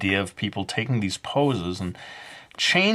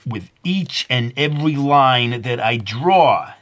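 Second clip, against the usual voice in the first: slow, louder put-on Brooklyn accent; 4 semitones higher, 7 dB louder, slower than usual. Artist narrating his drawing to viewers, in a mock Brooklyn accent. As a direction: lean into a hammed-up Brooklyn accent. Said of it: "I'm back to doing the Brooklyn accent".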